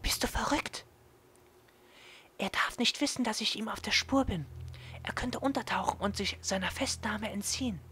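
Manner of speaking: high, urgent half-whisper; hushed girl voice. Acted. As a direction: hiss it in secret. Yuko hushed, narrator voiced.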